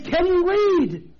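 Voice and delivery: high, slow, pointed question; mock condescension. Preacher repeating his blunt translation, mock condescending. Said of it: "It literally means".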